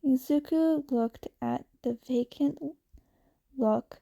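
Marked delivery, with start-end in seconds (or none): none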